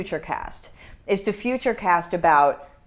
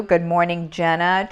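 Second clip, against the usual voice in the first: usual speaking voice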